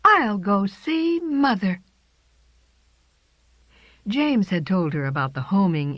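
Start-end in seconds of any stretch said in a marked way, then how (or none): 0.0-1.8 put-on young cat voice. A narrator's reading. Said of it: "She answered herself".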